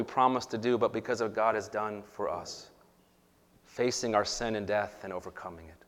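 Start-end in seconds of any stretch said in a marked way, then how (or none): none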